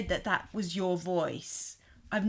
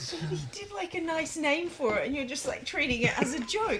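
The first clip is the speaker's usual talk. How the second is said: mock scolding; 6 semitones higher than usual. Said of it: "How rude".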